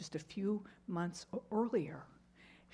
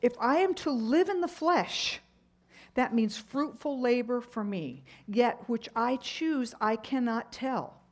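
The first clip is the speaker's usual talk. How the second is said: loud, high proclaimed reading; preacher reading verses at full force; scripture read with force; 3 semitones higher, 4 dB louder than usual. Acted. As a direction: declaim Paul's words from the pulpit. Reads Paul's words forcefully.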